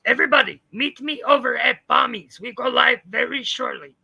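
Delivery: loud announcement to the crowd; big rallying shout; calling out. Real, not acted. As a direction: call everyone over at full volume. Calling viewers to next live.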